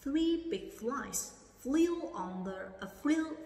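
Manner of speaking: "Three big flies flew in the pie" at half speed, each word separate; very slow model reading. Shown as a demonstration. Teacher reading very slowly for students to repeat. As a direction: stretch every word slowly. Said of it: "Cô đọc lại ha".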